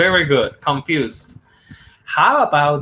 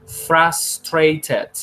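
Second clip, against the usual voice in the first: ordinary talk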